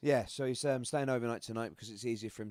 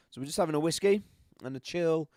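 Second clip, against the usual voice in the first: usual speaking voice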